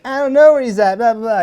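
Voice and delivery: high, loud put-on protest; mock stripper. Stripper denying all to a cop, high mock protest. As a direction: imitate a stripper brushing off a cop. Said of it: "it's gonna be some strippers like".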